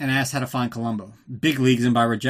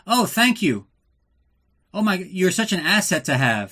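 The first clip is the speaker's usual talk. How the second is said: higher and louder, dripping fake gratitude; sarcastic mock thanks; 6 semitones higher, 4 dB louder than usual. Bond sarcastically thanking Kristatos at the card table. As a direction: gush it with heavy sarcasm.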